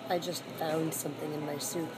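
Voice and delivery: ordinary talk, nothing marked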